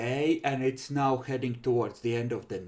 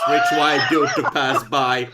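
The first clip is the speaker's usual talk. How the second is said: laughing shout; 11 dB louder than usual. Acted. GM voices bucket thrower yelling.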